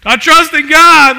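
loud, high, slow cry of faith; joyful declaration. Retold or imitated. Crowd won over by a tiny sermon, loud and high. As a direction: cry it out like a convert at the altar. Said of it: "and people just like".

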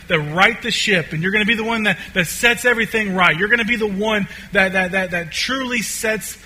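insistent disciples' objection. Acted. Disciples telling Jesus what he is supposed to do, insistent. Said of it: "sense in the disciples' hearts this".

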